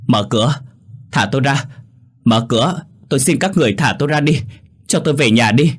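high-pitched, desperate plea, mildly shouted; crying out. A narrator's reading. Locked-in girl begging captors at the door, crying out. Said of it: "rồi giơ tay đập cửa thét lên".

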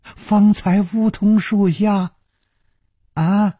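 put-on puzzled, drawn-out, high elderly voice; baffled old nun, stretching each phrase; slow old nun. A narrator's reading. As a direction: answer slowly as if humouring a madman. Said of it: "缓缓地说".

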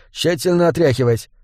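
ordinary delivery

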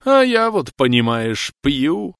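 tearful. Acted. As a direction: voice catching with drunken tears. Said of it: "со слезой в голосе произнёс".

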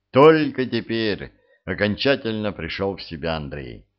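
usual speaking voice

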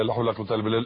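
gabbled quickly, syllables swallowed; hurried careless recitation; rushed. Shown as a demonstration. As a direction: rattle off لا حول ولا قوة إلا بالله carelessly fast. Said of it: "مش لا حول ولا قوة إلا بالله".

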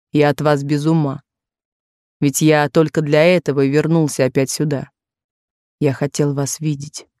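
Kane voiced low. A narrator's reading. Suitor to Jennie, low and insistent.

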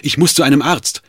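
loud, high desperate call; urgent shout. Acted. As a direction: cry out for help. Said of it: "rief er dem Herzog zu".

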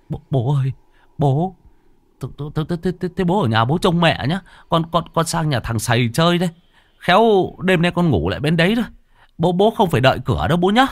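high, hurried stuttering son; flustered stammer. Acted. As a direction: stammer and rush away scared. Frightened son stammering excuses. (lắp bắp hoảng hốt)

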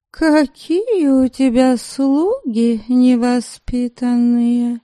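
high, slow, spoiled whine; whiny complaint. Acted. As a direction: complain like a pampered girl. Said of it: "пожаловалась она Дариану".

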